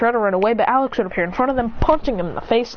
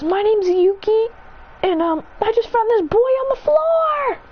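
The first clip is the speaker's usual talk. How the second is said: put-on girl's voice; 13 semitones higher than usual. Girl explains to Remy and Alex she found him, high girlish voice. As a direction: lift into a young girl's nervous voice.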